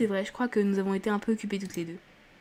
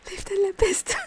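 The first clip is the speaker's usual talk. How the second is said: voix suraiguë étranglée de rire; hilare; 11 semitones higher, slower than usual. Real, not acted. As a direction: piailler en pouffant de rire. Rit de la réplique qu'elle lit. (hilare, la voix qui part dans les aigus)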